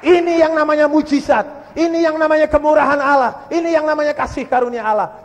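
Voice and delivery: loud, high, ringing preaching; triumphant proclamation. Real, not acted. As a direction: proclaim the miracle at full force.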